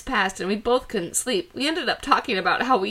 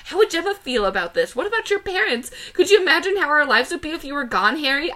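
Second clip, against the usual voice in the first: high-pitched, upset scolding; acted yelling; 6 semitones higher than usual. A narrator's reading. Emily confronting Harry in the basement, high acted yelling. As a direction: scold him in a mildly hammed-up yell. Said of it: "I yelled at him".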